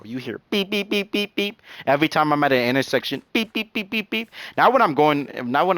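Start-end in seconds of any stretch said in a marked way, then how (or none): 0.5-1.6 high-pitched, rapid-fire car-horn beeps; imitated insistent horn. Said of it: "I be honking that like no tomorrow". 3.3-4.3 high-pitched, rapid-fire car-horn beeps; imitated insistent horn. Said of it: "I be honking that like no tomorrow".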